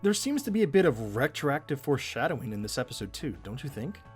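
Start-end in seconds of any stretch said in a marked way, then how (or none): none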